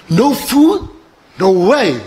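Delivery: high questioning outburst of disbelief; incredulous. Acted. Passenger hearing there's no food, acted incredulity. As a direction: act appalled at the news.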